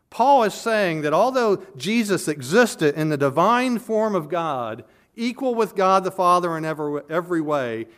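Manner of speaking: high, loud preaching push; preaching at full force. Acted. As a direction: drive the point home from the pulpit. Preaching emphasis.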